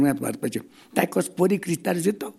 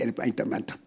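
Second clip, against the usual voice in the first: normal talking voice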